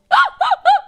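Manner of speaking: shrieking high-pitched laughter; high loud laugh. Real, not acted.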